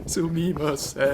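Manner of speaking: slow, drawn-out Sumimasen with a foreign ring; mocking the streamer's apology in Japanese. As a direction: say Sumimasen slowly, like a stiff mock apology. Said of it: "saying sorry in broken English".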